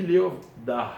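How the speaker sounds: "Léov Da" split up, final h breathed out; careful teacher's "Léov Da" with exhaled ending; demonstrated pronunciation. Shown as a demonstration. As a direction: set "Da" apart and end it on an audible h. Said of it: "Donc je, je dis".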